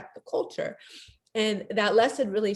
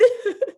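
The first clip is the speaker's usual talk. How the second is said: tearful laugh; 4 dB louder than usual. Real, not acted. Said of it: "I've never cried like this before".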